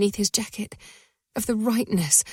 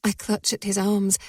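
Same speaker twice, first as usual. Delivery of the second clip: ordinary delivery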